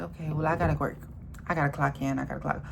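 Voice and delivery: soft, dull mock worker voice; mocking drone. Vlogger mocks complacent workers in a soft drone. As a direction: deflate into a tired drone. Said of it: "we're just like".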